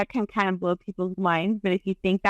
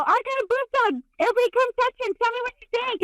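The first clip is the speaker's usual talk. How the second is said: much higher, gushing mock friend voice; 13 semitones higher than usual. Retelling a friend who invites everyone to touch, high mock voice. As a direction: pitch way up like the friend showing off. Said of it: "I had a friend that go".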